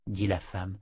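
usual speaking voice

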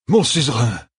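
high cry of amazement; exclaimed cry. A narrator's reading. General cries out on seeing his king, high exclamation. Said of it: "s'écria Ituralde".

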